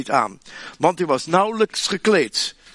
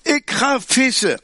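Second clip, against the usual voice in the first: lightly voiced Peter, slower and higher; 8 semitones higher, slower than usual. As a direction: Peter announcing he goes fishing, a touch higher. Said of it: "Simon Petrus zei". (Petrus kondigt aan dat hij gaat vissen, iets hoger en trager)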